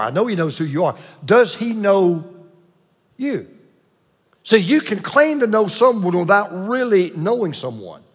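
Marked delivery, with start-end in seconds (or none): 1.2-3.5 "you" set apart after a long pause, slow; hold, then hit "you"; stress on you. Shown as a demonstration. Pastor presses the congregation, pausing before "you". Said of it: "I didn't say, "Does he know who you are?".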